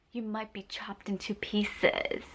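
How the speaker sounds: hushed creepy whisper of a warning; soft scary-story whisper; spooky whisper. Acted. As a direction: whisper it to scare the listener.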